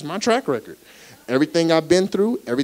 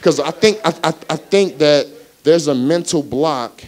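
preaching; 3 semitones higher, 6 dB louder than usual. Acted. Louder preaching.

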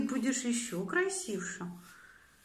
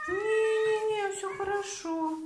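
squeaky praising a cat; 6 semitones higher, 3 dB louder than usual. Real, not acted. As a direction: squeak reassurance to a nervous pet. Owner soothing cat at groomer.

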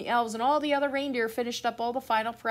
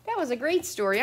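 ordinary delivery

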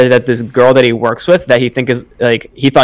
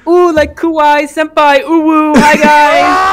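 hammed-up cute anime voice, much higher; acting cute; 5 dB louder, much slower than usual. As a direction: squeal it like a cutesy fan calling senpai. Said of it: "acting all, like, cute".

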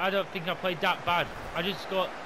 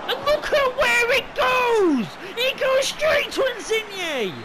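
slow, high, loud disbelieving shout; outraged yell; 9 dB louder, slower than usual. Real, not acted. Gamer watching the ball go wrong, slow outraged yell. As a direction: bellow in outrage, stretching it.

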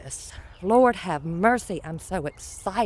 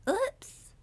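"Oops" jumps much higher, rising at the end; a high rising cartoonish "Oops"; squeaky high oops; 13 semitones higher, 6 dB quieter than usual. Real, not acted. Gardener reacting to her cat's hairball, a high squeaky "Oops".

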